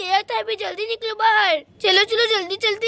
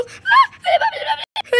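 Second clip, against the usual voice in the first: high, loud shriek of fright; shriek, then gasp in terror; terrified scream; 14 semitones higher, 4 dB louder than usual. Acted. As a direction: scream as the ghost appears. Girl sees the ghost.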